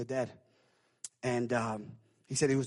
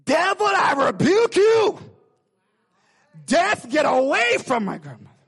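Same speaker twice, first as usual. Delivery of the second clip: put-on child rebuking death; 13 semitones higher, 11 dB louder than usual. Granddaughter commanding death away from her grandmother, high loud shout. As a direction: rebuke the devil at full force. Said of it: "this child, the granddaughter, saying".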